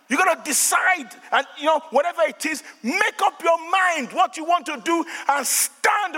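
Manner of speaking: high, emphatic exhortation; fired-up preaching. Real, not acted. Preacher urging church to decide, high forceful preaching. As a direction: hammer each point to the congregation.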